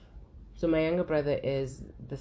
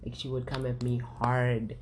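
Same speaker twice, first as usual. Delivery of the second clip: ordinary delivery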